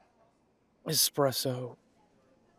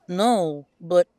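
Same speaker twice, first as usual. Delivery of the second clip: loud, higher, emphatic refusal; firm no; 4 semitones higher, 10 dB louder than usual. Acted. Chester refuses to go back to Boston, loud. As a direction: shut it down hard.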